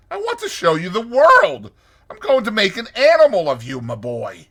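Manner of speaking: high, loud, insistent pleading; imploring. A narrator's reading. Toad urging Rat to leave the river, imploring. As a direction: press him hard, pitched well up. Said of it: "said Toad imploringly".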